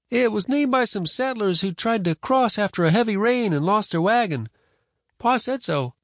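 put-on high-pitched boy correcting his elder; insistent boy. Boy correcting Grandma about river's name, insistent.

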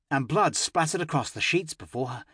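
plain reading voice

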